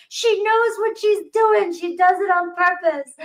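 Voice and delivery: put-on a worn-out mother half laughing at her toddler; frustrated mother. Mother laughing about her mischievous daughter, high and exasperated. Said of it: "She's like".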